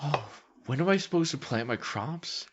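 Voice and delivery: whispered farmer question; breathy whisper, then answer. Retold or imitated. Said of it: "They're like".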